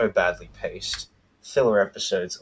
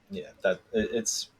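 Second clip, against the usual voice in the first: normal talking voice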